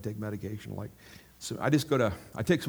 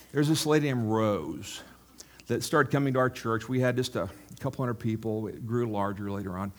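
ordinary talk

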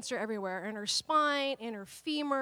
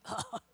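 exasperated groan; 11 semitones higher, 7 dB quieter than usual. Retold or imitated. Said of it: "you're just like".

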